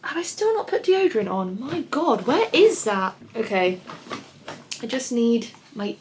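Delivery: ordinary delivery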